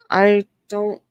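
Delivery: ordinary delivery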